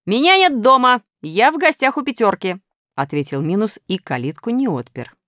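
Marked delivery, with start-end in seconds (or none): 0.1-2.6 shouted fib. A narrator's reading. Минус кричит из-за забора.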